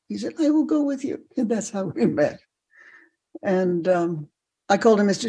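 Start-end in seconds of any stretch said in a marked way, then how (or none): 0.4-1.3 put-on much higher French-accented offer. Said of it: "in a French accent".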